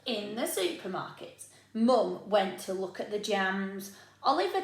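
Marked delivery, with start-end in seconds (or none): none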